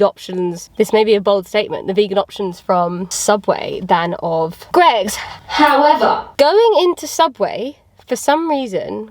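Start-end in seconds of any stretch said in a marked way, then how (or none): 5.2-9.1 high, drawn-out buildup; dramatic build-up. Real, not acted. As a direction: draw out the however. Building up to confession of anxiety.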